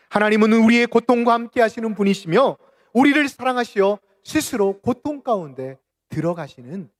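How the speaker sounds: loud, high proclamation, easing at the end; impassioned preaching. Acted. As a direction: proclaim it with full pulpit force. Preaches the realization at full force.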